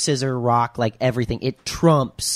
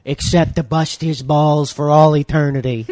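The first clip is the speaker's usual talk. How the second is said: mafioso wedding officiant; 6 dB louder than usual. Retold or imitated. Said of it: "It's like a mafioso Italian wedding".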